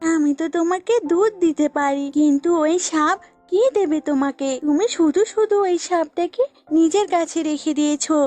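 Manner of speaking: lightly voiced goat, arguing its case; jealous goat. Goat argues it gives milk, mildly indignant. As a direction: protest with a touch of jealousy.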